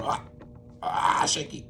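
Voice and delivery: normal talking voice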